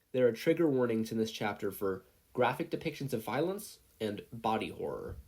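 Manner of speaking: plain reading voice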